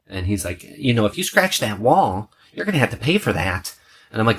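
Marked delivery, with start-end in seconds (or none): 0.6-3.8 mock the guy in the kitchen, pitched up; imitating the intruder. Intruder lectures him, voiced.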